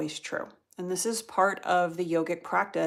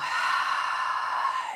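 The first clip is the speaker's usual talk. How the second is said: long, voiceless rush of breath; empty the lungs in one audible sigh; audible exhale. Shown as a demonstration. Yoga teacher showing students how to exhale, long breathy sigh.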